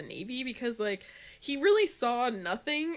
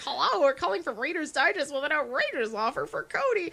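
telemarketer voice; 6 semitones higher, 3 dB louder than usual. Retold or imitated. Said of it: "In Scary Movie three".

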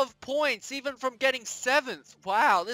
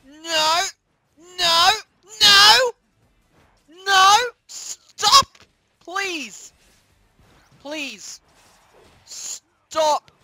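rising louder higher no's; desperate shouts; 8 semitones higher, 9 dB louder, much slower than usual. Real, not acted. Gamer pleading as he is beaten, desperate shout. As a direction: scream it to stop it. Escalating pleas while losing.

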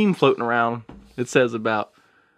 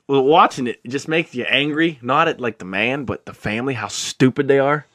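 normal talking voice

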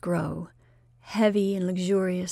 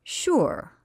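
half-hearted sure; 6 semitones higher than usual. Acted. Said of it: "There was a half-hearted".

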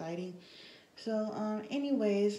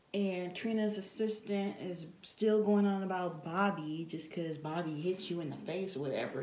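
ordinary talk, nothing marked

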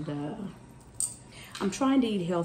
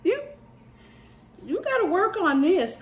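scolding herself; 12 semitones higher, 3 dB louder than usual. Retold or imitated. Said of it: "I be saying".

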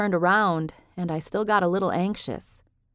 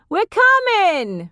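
much higher, loud, slow shout; 13 semitones higher, 7 dB louder, much slower than usual. A narrator's reading. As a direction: call back across the apartment. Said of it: "Viv shouted".

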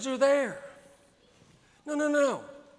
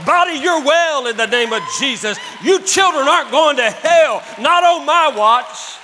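louder, higher, commanding preacher's shout; 3 semitones higher, 10 dB louder than usual. Acted. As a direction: order the body to be well. Quoted preacher commanding body.

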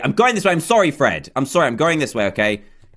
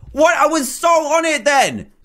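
high, exasperated protest; outraged high exclamation; indignant disbelief; 11 semitones higher, 3 dB louder than usual. Real, not acted. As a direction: protest the fall, pitch leaping up. Protests falling off the ladder.

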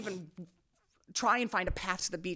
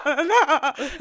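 high, loud mock scream; retold scream-crying; 6 semitones higher, 8 dB louder than usual. Retelling her run down the beach, mock scream-crying. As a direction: wail the name as if searching, half laughing. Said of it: "I started scream crying your name".

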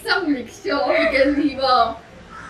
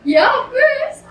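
laughing; 12 semitones higher, 6 dB louder, slower than usual. Real, not acted. Laughing through disgust at food.

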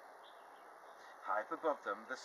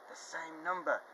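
higher, mildly raised call; shouted; 7 semitones higher, 4 dB louder than usual. Acted. Spinderella calling down to the class, mildly shouted. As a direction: call it out, a touch excited. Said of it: "shouted Spinderella".

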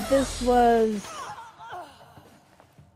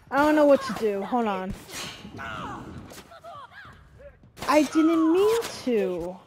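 panicking gamer; 7 semitones higher, 3 dB louder than usual. Real, not acted. Combat chaos, apologizing to NPCs.

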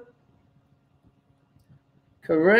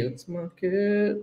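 quiet; 9 dB quieter, slower than usual. Real, not acted. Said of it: "repeats it differently".

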